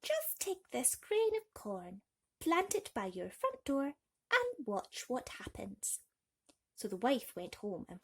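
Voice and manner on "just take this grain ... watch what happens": high, slow crone voice; slowly dole out the magic instructions; wise old woman. Acted. The old woman giving the grain of corn, slow crone. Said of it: "replied the old woman".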